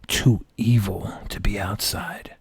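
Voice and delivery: soft spooky whisper; hushed scary-story aside. Acted. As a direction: drop to a secret hush.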